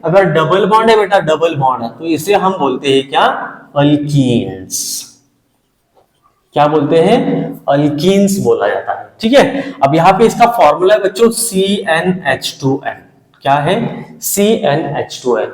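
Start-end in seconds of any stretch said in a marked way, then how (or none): 3.8-4.8 drawn-out key term. Shown as a demonstration. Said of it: "teacher drills new term". 11.3-13.0 slow dictation. Shown as a demonstration. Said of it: "dictating formula to class". 14.2-15.5 slow dictation. Shown as a demonstration. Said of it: "dictating formula to class".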